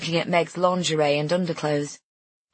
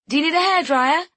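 high, loud offer; yelling; 11 semitones higher, 5 dB louder than usual. A narrator's reading. Friend offers help through door, yelling. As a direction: shout the question to another room. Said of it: "she yells".

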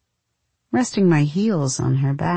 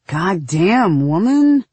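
loud exclamation; 2 semitones higher, 4 dB louder, slower than usual. A narrator's reading. Husband's exclamation after lovemaking.

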